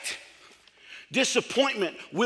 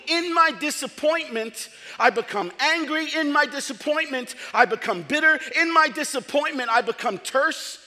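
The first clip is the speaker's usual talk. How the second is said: building preach; 4 semitones higher than usual. Acted.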